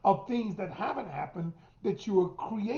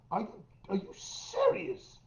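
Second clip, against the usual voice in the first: much higher, disbelieving "are you serious"; incredulous; 10 semitones higher, 3 dB quieter than usual. Retold or imitated. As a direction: squeak it out in disbelief. Incredulous inner reaction to partner.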